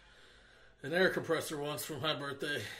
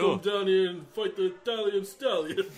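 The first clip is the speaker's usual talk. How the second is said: Sylvester Stallone; 2 semitones higher, 7 dB louder than usual. Acted. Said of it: "What if Sylvester Stallone was it?".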